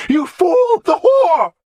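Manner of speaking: high, loud shriek at the king; raving cry; shout. Acted. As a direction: scream it in his face. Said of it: "Godwin shouted at Alfred".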